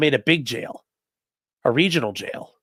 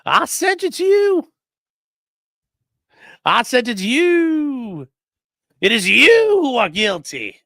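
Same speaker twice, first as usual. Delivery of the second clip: much higher, slow, theatrical robot-judge pronouncement; Judge Ralph; 9 semitones higher, 5 dB louder, slower than usual. Pretend. Joking about an AI Judge Ralph, high and slow mock verdict. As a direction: intone it slowly, like an AI judge passing sentence. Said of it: "Yeah, Judge Ralph".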